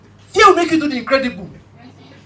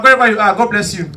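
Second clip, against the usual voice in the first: usual speaking voice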